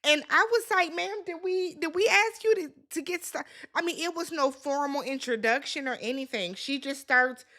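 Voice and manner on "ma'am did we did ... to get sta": high, incredulous ma'am, did we; mock-indignant high ma'am. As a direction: scold her for overstepping. Host scolding the prophet.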